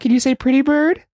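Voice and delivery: high sing-song voice to a bird; talking to a parrot. Retold or imitated. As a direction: coax a bird to talk. Teaching a parrot to talk.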